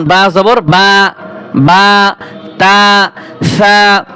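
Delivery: "বা, তা, সা" with a wide-open, held "aa"; open-mouthed vowel. Shown as a demonstration. Teacher shows students the zabar sound, open-mouthed vowel. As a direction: drop the jaw open on "বা, তা, সা". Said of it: "হা করা থাকবে".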